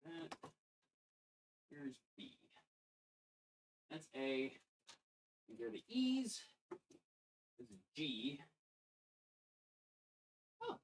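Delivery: quietly reading labels under breath; muttering to self. Real, not acted. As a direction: murmur the part names to yourself. Murmuring part labels to self.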